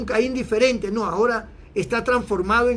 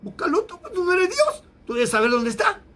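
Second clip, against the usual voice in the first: higher, sarcastic retort to God; mocking Cain; 9 semitones higher than usual. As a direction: throw it back at God, hammed-up. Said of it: "lo que le quiso decir".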